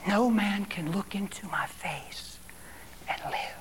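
imitating God's soft whisper. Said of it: "He whispered to me".

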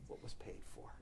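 refrain sinking into a hush; fading to a whisper. Real, not acted. Preacher repeats refrain to the congregation, fading to a whisper. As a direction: let the last words drop to a whisper. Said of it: "repeated refrain".

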